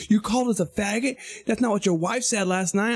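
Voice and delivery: high, affronted comeback; imitated upset retort. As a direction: mimic offended drivers answering back. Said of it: "they got all upset".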